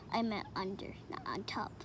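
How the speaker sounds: quiet whispered correction. Real, not acted. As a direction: whisper it to yourself. Whispered self-correction, then laugh.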